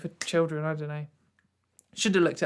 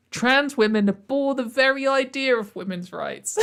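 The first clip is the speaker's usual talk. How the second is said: mocking quote; 5 semitones higher, 6 dB louder than usual. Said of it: "quoting a claim".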